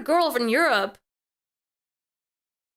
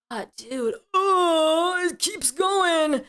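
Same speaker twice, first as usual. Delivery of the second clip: drawn-out, whiny groan; exasperated wail; much slower than usual. Real, not acted. Streamer finding yet more flirty messages, wailing. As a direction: wail it slowly in mock despair.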